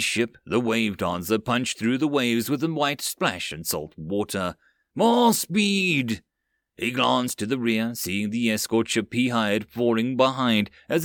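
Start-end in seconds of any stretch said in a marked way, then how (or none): 4.9-6.2 high urgent shout; yelling. Acted. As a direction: push the crew harder. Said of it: "Amaj yelled".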